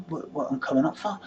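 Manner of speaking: ordinary delivery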